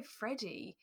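ordinary talk, nothing marked

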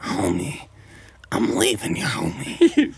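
raspy, unsympathetic cartoon wife impression; Marge Simpson. Wife leaving sad husband, mock unsympathetic. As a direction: rasp it like a fed-up cartoon wife. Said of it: "Marge having no sympathy for him".

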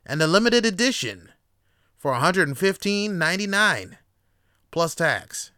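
loud, high salesman hype; mock infomercial host; infomercial pitch. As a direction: sell it like a late-night TV ad.

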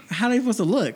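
indignant; exasperated. Real, not acted. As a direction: vent the frustration. Exasperated rhetorical.

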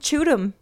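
choot 'em with ch sound; Louisiana choot. Shown as a demonstration. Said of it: "the word choot".

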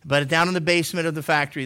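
ordinary talk

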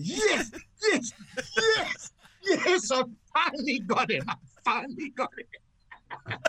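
very high, triumphant whoops; gleeful cheering. Retold or imitated. Golfer celebrates getting his friend at last, cheering. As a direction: cheer wildly in retold victory. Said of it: "I just started cheering".

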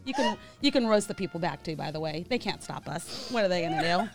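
ordinary delivery

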